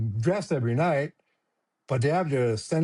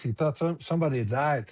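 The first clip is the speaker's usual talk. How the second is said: usual speaking voice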